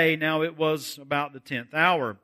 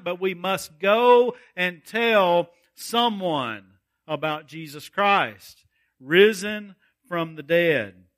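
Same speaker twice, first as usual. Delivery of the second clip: preaching at full force; 4 semitones higher than usual. Acted. Sermon rises to full force.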